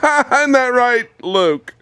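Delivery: laughing, drawn out high at a goat. Real, not acted. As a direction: tease the goat through a laugh, slowly. Teasing goat while laughing.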